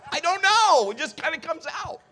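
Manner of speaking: high-pitched comic outburst, loud then easing off; helpless protest. Acted. Husband defending his blurting to his wife, high helpless protest.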